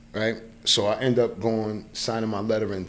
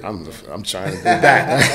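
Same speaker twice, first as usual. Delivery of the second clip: laughing; 7 dB louder than usual. Real, not acted. Joking about turning forty-six.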